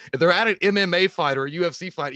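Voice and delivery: ordinary talk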